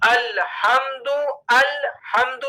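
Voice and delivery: الحمد with open "al" and thin L; light laam. Shown as a demonstration. Teacher modelling for learners, الحمد with light "al". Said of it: "کیا کہیں گے؟".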